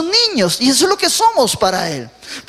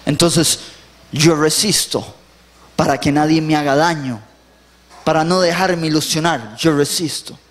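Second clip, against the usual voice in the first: hardened person; 8 semitones lower than usual. Retold or imitated. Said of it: "tienen que hacerse duros".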